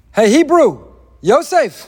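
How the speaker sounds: loud, high call into the cell. Acted. The jailer calls Yosef out of the pit, loud. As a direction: summon the prisoner.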